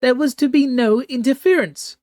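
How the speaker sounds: high, loud imperious screech. Acted. Empress Lawan scolding the Kabbalist through the mirror, high loud screech. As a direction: shriek the rebuke down at the mirror. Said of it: "she screeched".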